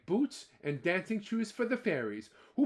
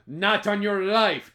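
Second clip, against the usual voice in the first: slow, louder gruff refusal; firm refusal; 3 semitones higher, 10 dB louder, slower than usual. Acted. Jamie refusing the leprechaun, slow and loud. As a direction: hold your ground, loud. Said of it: "Jamie said".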